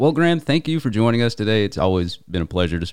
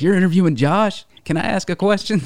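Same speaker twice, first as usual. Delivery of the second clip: excited; 9 semitones higher than usual. Retold or imitated. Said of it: "She's like".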